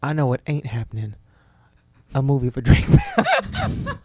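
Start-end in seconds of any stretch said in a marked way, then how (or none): 2.1-4.0 high, slow mocking laugh-talk. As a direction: sneer it out, laughing. Mock diss of [name].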